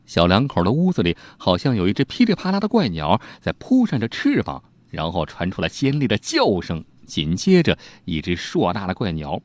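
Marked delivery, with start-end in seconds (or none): none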